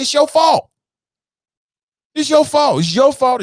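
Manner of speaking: loud, high accusation; accusing shout. Real, not acted. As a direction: hurl the blame at him. Angry rant at the president.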